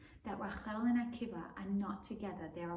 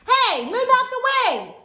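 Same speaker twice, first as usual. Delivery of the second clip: very high, very loud shout; shouting students; 18 dB louder than usual. Acted. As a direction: clear the road, yelling. Students push Rachel aside.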